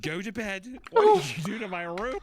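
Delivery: put-on high loud outraged child; indignant kid voice. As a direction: shout like an offended kid.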